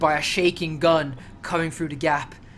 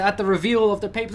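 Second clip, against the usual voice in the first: usual speaking voice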